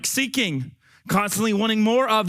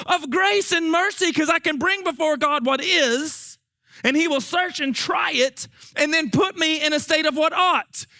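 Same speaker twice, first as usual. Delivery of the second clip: raised, fervent preaching voice; building pulpit fervor, pitched higher; 7 semitones higher than usual. Real, not acted. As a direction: lift it up and preach it out.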